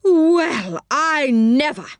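high, drawn-out outrage; indignant cry. A narrator's reading. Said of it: "his big round face getting redder and redder".

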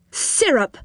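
firm command to a magic jug. A narrator's reading. Joe commanding the jug firmly. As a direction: say it like an order the jug must obey. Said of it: "he said to the jug in a firm voice".

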